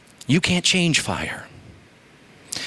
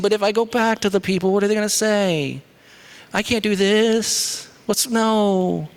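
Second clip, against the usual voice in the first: higher, whiny pleading Moses; whining Moses; 7 semitones higher than usual. Acted. Moses complaining to God, higher whiny plea. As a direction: whine it like a reluctant Moses. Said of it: "Moses starts saying".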